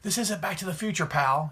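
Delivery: mock bent out of shape; high, put-on indignant voice; imitating Kevin Owens. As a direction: play an irritated wrestler scolding a rival. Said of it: "Owens could get all bent out of shape".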